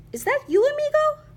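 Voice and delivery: very high, loud, delighted surprise; exclaiming. A narrator's reading. Kitty boy recognizing his friend in space, surprised exclamation. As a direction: shoot the pitch right up in amazement. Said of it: "exclaimed Scapito".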